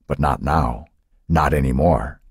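usual speaking voice

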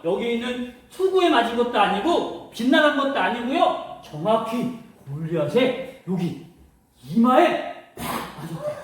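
ordinary delivery

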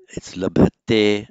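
labhate said loud and slow with a clear final e; correct ending. Shown as a demonstration. Teacher correcting the verb ending for students. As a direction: lean on the -te of labhate. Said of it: "not labhati".

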